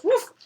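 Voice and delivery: high, loud little woof; high puppy-like bark; dog woof. Acted. Voices the dog in story.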